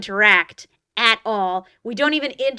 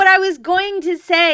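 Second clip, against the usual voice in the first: raised voice, talking over the interruption; insistent; 9 semitones higher, 6 dB louder than usual. Real, not acted. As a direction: push through loudly, higher, to finish the point.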